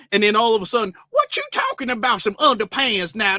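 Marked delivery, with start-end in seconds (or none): 1.1-3.4 higher, exaggerated street-slang retort; mock urban slang. Store worker answering a too-proper shopper, hammed-up slang voice. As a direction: play a baffled worker in broad slang.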